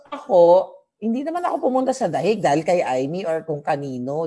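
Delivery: ordinary talk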